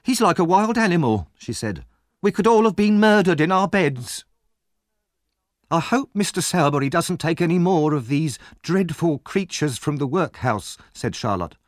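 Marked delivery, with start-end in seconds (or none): 0.0-1.3 high-pitched, horrified complaint; aghast, pitched well up; shaken alarm. Acted. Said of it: "breathing heavily". 2.2-4.2 high, loud dismay; aghast and dramatic, pitched high; horrified alarm. Acted. Shaken woman after violent attack. 5.7-10.8 slightly affected high-pitched disapproval; a touch of outrage; indignant complaint. Acted. Maid indignant after the attack.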